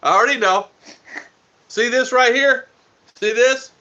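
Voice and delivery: playful loud pointing out; excited. Real, not acted. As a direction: show it off with a loud high push. Showing wife something, teasing.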